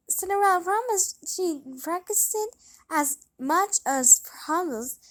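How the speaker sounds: ordinary delivery, nothing marked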